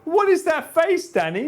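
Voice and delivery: high amused disbelief; laughing. Real, not acted. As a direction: laugh through the question. Laughing at a face on screen.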